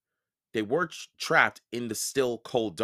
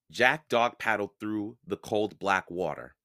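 ordinary delivery

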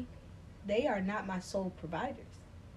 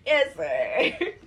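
laughing; 6 semitones higher, 6 dB louder than usual. Real, not acted. Laughing assent.

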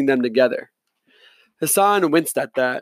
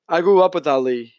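plain reading voice

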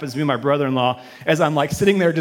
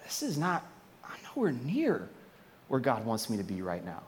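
much softer, lowered dismay; almost whisper the realization; hushed realization; 7 semitones lower, 14 dB quieter than usual. Retold or imitated. Believer realizing they're far from God, hushed dismay. Inner realization of being lost.

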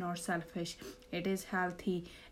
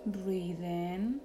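soft, slow meditation voice; calm, hushed, lead them into relaxation; guided meditation; 8 dB quieter than usual. Acted.

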